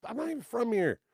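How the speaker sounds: high pleading denial. Retold or imitated. Retold pleading reply.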